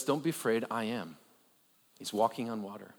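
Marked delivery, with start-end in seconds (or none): 0.0-1.2 quiet Jesus. Acted. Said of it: "Jesus says".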